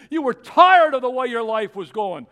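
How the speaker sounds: loud, high rhetorical challenge; preacher at full cry; full-force preaching. Acted. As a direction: press the congregation hard.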